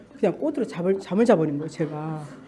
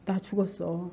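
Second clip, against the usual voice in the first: soft, low-key insistence; calm retort; 4 semitones lower, 11 dB quieter than usual. Retold or imitated. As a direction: answer the taunts quietly. Calm first answer to devil's taunts.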